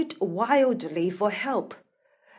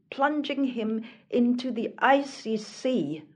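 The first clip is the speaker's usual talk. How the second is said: plain reading voice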